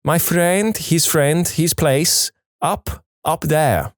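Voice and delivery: put-on high, halting broken Chinese accent; pointing, pleading in a Chinese accent. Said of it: "my English breaks down utterly".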